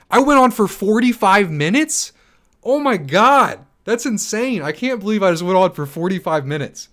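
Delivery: can't believe what you just learned; astonished disbelief. Real, not acted. Streamer learns he ranted for forty-five minutes, astonished. Shocked at length of own rant.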